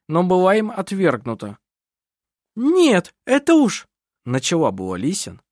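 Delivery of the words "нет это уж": high, louder burst of objection; outraged, high-pitched interjection; indignant protest. A narrator's reading. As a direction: start to object sharply. Lisin starts to protest, gets cut off.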